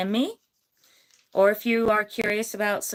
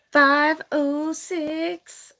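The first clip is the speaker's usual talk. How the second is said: dramatic announcement; 5 semitones higher, much slower than usual. Real, not acted. Announcing winning number.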